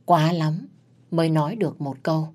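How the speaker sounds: ordinary delivery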